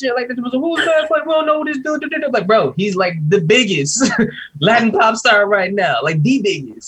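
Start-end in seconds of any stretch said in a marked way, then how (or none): 0.4-2.3 high, mimicked clueless complaining; mock bewilderment. Mimicking Americans wilding on Twitter, mock baffled. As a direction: parody baffled fans, voice pitched up.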